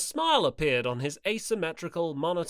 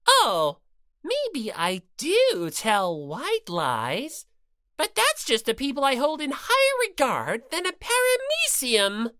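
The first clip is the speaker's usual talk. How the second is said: lightly voiced bossy bear mascot; Monokuma voice; 10 semitones higher, 5 dB louder than usual. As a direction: lecture her with smug cruelty. Monokuma smug.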